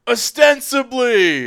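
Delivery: high, loud, stretched-out cry; hammed-up ceremonial reply. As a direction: proclaim it with mock gusto. Acted response in imagined ceremony.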